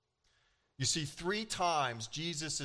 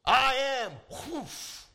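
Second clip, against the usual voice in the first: much higher and louder burst; Jesus' words knocking the crowd down, loud and high; booming declaration; 6 semitones higher, 6 dB louder, much slower than usual. Acted. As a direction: blast it out with power.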